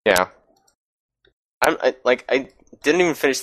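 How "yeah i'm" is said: ordinary talk, nothing marked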